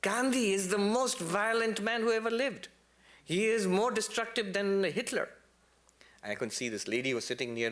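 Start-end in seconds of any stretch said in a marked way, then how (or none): 0.0-5.3 higher, slowing Eastern European accent, imitating Žižek. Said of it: "this heavy, uh, Eastern European accent".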